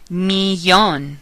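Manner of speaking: Spanish "millón" with a Y sound for the LL; double L as Y. Shown as a demonstration. Teacher modelling double L words, Y sound in "millón". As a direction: say "millón" as "mee-YOHN". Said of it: "sounds like the Y in the word yes".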